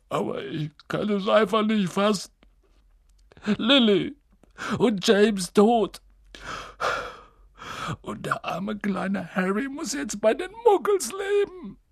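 high, breaking wail of grief; tearful lament. A narrator's reading. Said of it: "schluchzte Hagrid".

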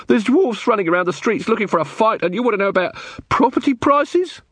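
high, indignant rant; exasperated Muldoon. Acted. Muldoon to Carrot over property questions during a riot, exasperated. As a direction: protest at a ridiculous question.

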